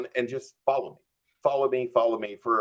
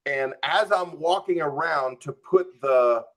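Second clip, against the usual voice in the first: ordinary talk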